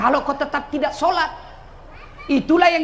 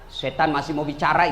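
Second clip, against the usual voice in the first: ordinary delivery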